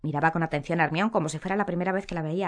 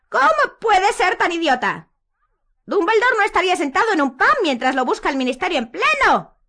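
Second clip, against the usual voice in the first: Umbridge shouting; 13 semitones higher, 8 dB louder than usual. A narrator's reading. Umbridge shouting at Hermione in disappointment. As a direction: scold her as stupid. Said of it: "gritó la profesora Umbridge".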